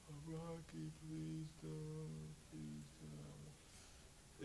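a soft pleading plea to Rocky; Adrian pleading. Retold or imitated. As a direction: beg him gently not to fight, as Adrian. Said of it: "it's a character".

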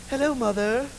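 lightly voiced well-mannered kids; a hint of prim children greeting mom; polite children.

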